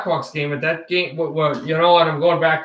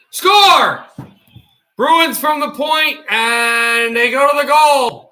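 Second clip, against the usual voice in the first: excited goal call; 6 semitones higher, slower than usual. Acted. Streamer calling the Bruins' tying goal, excited shout. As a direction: belt out the tying goal like a thrilled announcer.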